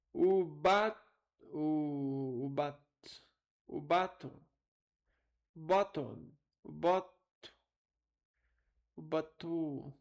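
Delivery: "batom" tried as "BA-tom", "bottom", "batu", weight moved to the first syllable; distorting the ending. Shown as a demonstration. Teacher trying to disfigure the "om", haltingly mispronouncing "batom". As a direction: stumble through experimental versions of "batom", punching "ba". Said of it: "descaracterizar esse on".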